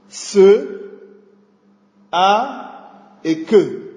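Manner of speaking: each letter of "sac" named slowly and apart; spelling out letters. Shown as a demonstration. Teacher spelling the word "sac" to a student, slow and separate. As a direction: spell "Ce, a et que" letter by letter, drawn out. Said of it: "il a trois lettres distinctes".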